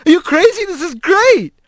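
high, excited outburst; overjoyed, pitch leaping up; thrilled. A narrator's reading. Doorkeeper pumping Rat's paw, thrilled. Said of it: "he started laughing".

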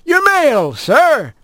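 high, chirpy bird voice; bright little mail sparrow; postbird voice. A narrator's reading. Postbird character voice.

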